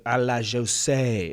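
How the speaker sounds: slow, hammed-up fake French phrase; mock French flourish. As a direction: toss it off in a joking French accent. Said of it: "whatever juicy is in French".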